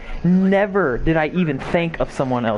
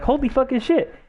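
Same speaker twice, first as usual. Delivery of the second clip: high, disbelieving whoop; thrilled exclamation; 12 semitones higher than usual. Retold or imitated. Retelling his own shocked joy at her text. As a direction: pitch it way up in stunned glee.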